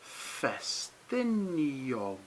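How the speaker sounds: "Ffestiniog" stretched very slowly, ff as f; very slow Welsh demo. Shown as a demonstration. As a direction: draw out "Ffestiniog" for the class. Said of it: "double F was an F sound".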